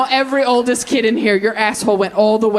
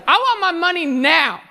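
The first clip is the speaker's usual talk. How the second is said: bratty younger son; 7 semitones higher than usual. Retold or imitated. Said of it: "the younger son is like".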